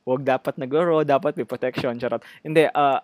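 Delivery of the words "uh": ordinary delivery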